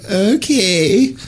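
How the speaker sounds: high, breathless rising okay; swooning okay. Retold or imitated. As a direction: melt at the offer. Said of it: "I'd be like".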